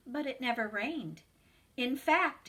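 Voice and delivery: ordinary delivery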